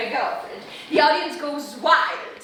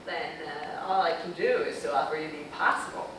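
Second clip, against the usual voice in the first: director's voice; 10 semitones lower, 4 dB quieter, faster than usual. Retold or imitated. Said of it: "He said".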